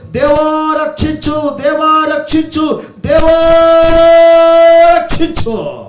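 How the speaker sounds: high, loud drawn-out plea; imitated wailing cry. Preacher voicing desperate people pleading for rescue, wailing cry. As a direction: cry out to God with long stretched vowels. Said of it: "ఏడుస్తున్నారు".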